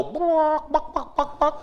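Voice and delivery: high, laughing mock barks; playful pretend dog barks; dog barking impression. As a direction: yap like a silly dog through a laugh. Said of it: "do an animal impersonation".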